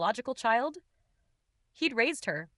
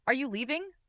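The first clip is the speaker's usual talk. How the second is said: mildly anxious, higher question; shaking voice; 5 semitones higher than usual. A narrator's reading. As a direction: ask with a touch of worry, pitched up. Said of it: "my voice shaking".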